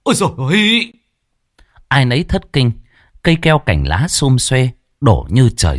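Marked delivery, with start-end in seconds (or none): 0.0-0.9 terrified cry. Acted. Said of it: "chỉ kịp rú lên một tiếng".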